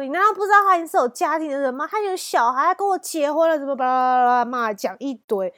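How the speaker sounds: fast, high, loud scolding; wife yelling down the phone at the speaker. Retold or imitated. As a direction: rattle it off like an angry wife. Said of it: "总之就是削了我一顿".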